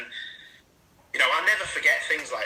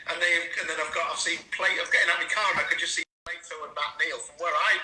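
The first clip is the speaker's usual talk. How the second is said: ordinary talk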